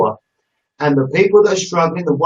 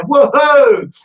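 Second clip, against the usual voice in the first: high, loud mock whoop; imitating a cheer; 9 semitones higher, 5 dB louder than usual. Host reading out a viewer's comment, mock cheering whoop. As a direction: relay the viewer's cheer playfully. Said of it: "Mike Allison just said".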